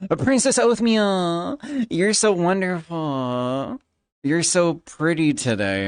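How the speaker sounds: high, slow, swooning mock admirer; high syrupy mock fanboy cooing; doting admirer. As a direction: gush like a lovesick fan.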